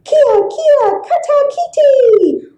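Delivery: put-on high squealing kid cries; panicked children. A narrator's reading. Village children fleeing the storm, high squeals. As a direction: squeal like kids running from wind.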